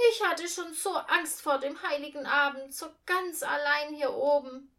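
put-on a delighted old lady being invited. A narrator's reading. Old Frau Strehlen thanks Uncle Jupp, delighted old voice. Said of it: "sagte Frau Strehlen".

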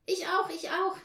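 high, loud chiming in; eager calling out. A narrator's reading. Children all claim they can light fires, eager calling out. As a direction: pipe up loudly and eagerly. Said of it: "riefen die anderen".

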